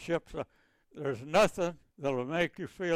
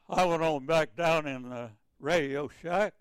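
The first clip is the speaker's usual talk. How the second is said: usual speaking voice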